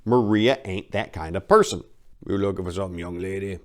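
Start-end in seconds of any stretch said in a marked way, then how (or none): 2.3-3.6 croaked. Acted. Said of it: "croaked the wrinkled".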